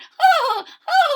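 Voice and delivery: very high howl of laughter; Fluffy howling with laughter. Acted.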